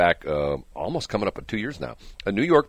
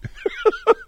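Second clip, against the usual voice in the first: a real squeal of laughter, very high; helpless high-pitched laugh. Real, not acted. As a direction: let the laugh fly up into a squeal. Laughing at his own story.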